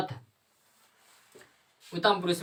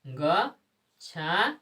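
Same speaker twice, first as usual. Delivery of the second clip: slow drawn-out demo; 3 dB quieter, much slower than usual. Shown as a demonstration. Teacher to class, reading the finished form very slowly. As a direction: stretch गच्छामी out very slowly, syllable by syllable. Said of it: "क्या लिख देंगे?".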